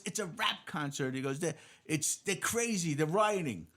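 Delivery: high, loud, frantic yelling; a cop yelling the news in the middle of a riot; frantic cop shout. Retold or imitated. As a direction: shout it like a cop caught in the chaos.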